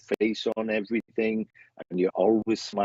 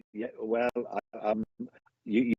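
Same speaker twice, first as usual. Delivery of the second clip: ordinary talk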